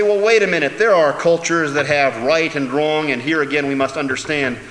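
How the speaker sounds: high, loud mocking objector voice. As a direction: parrot the objection loudly. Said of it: "lest you say".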